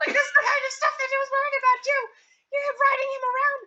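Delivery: high, fast exasperated scolding; scolding rant. Acted. Jen berating Talel after tackling him, fast high scolding. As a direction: scold him, rapid and shrill-pitched. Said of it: "cursing him slightly".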